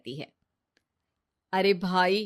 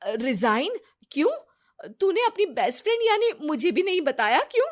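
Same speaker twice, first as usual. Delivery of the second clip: sharp high louder disbelief; shocked; 8 semitones higher, 4 dB louder than usual. Acted. Friend stunned her best friend hid resigning, shocked. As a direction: jump up in pitch with shock.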